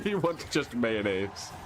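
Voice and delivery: ordinary delivery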